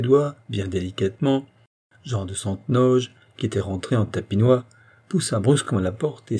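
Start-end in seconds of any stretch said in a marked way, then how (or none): none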